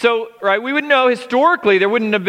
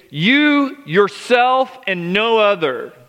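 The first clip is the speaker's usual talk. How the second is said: "you" and "yourself" raised and set apart, "no other" falling; very emphatic; slower than usual. Shown as a demonstration. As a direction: stress each piece, "you... yourself... and no other". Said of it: "it's very emphatic".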